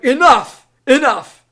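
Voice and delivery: high, loud mock outburst; exasperated. Human fed up with repeated questions, exasperated shout. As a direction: cut them off loudly, out of patience. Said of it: "If you as a human being were being questioned like this".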